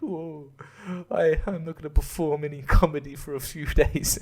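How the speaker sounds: mock solemn comic.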